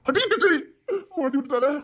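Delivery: sudden shout of alarm at being caught; alarmed outburst. Acted. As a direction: cry out as the trap springs. Caught, cries out.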